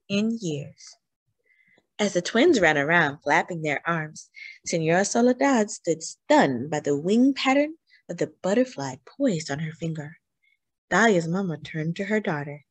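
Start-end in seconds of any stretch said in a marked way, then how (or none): none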